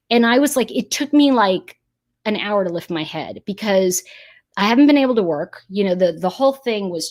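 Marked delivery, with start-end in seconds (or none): none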